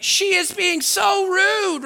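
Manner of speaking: high, drawn-out indignation; hammed-up offended complaint, stretched slowly; mock mad. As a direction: act scandalized by someone's rudeness. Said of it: "I'm just mad".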